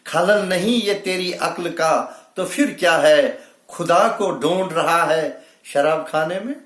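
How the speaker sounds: high, climbing mushaira declamation; poetry recitation. Acted. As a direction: pitch the verse up to the audience. Declaims couplet, repeats it higher.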